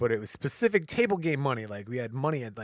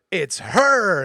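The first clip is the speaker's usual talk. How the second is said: louder, higher blaming jab; accusing; 6 semitones higher, 8 dB louder than usual. Retold or imitated. Lady at the table blaming the wife, accusing. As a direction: point the finger with your voice. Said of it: "And this lady goes".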